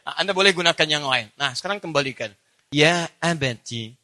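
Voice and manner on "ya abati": tender address. Shown as a demonstration. Son tenderly asking his father why. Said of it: "Ini yang indah".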